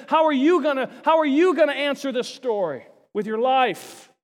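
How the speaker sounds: loud, pressing challenge to the crowd; challenging question. Acted. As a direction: hurl the question at them. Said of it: "he leaves the story and said".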